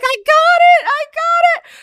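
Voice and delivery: put-on calling her boyfriend, shrieking with joy; thrilled shout. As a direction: yell it like you just got the part. Retelling news of landing role.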